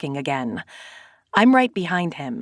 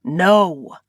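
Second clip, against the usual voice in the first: growled no. Acted. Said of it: "It's a growl of fury".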